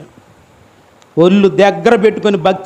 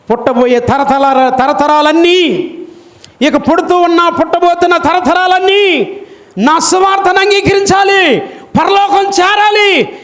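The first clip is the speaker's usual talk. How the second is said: high, shouted sermon climax; preaching at full force; fiery preaching; 7 semitones higher than usual. Acted. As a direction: thunder it over the congregation.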